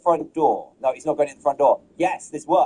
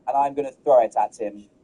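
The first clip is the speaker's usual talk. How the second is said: normal talking voice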